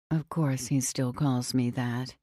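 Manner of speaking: usual speaking voice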